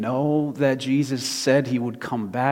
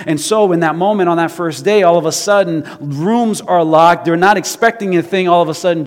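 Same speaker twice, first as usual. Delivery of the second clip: loud, raised preaching delivery; preaching at full force; 4 semitones higher, 7 dB louder than usual. Acted. Preacher telling of the locked room, loud and urgent preaching. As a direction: build the suspense loudly for a congregation.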